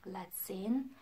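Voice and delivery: whispered invitation; softly so teddies sleep. Acted. Teacher whispering before the goodbye song.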